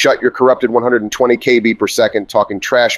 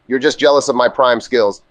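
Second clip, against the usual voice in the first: ordinary talk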